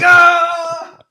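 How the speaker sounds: high, loud mock yell of a crowd; meme crowd yell. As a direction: yell like guys circling a fight. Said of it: "all the guys in the circle going".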